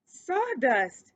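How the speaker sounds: loud, high outburst of shock; a hammed-up shocked cry; Ced learning he ate sawdust, loud shocked cry; mild shriek. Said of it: "shrieked Ced".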